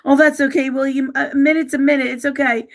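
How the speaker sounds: real crying laugh, trying to hold it together; reassuring a viewer while choking up; laughing through tears. Real, not acted. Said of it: "I don't wanna get emotional".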